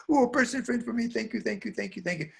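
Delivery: put-on high, delighted child voice; thrilled little girl. Girl delighted by new friend.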